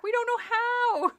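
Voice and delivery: high, loud and slow; panicked shout. Acted. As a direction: drag it out in panic. Continues Chris's panicked shout.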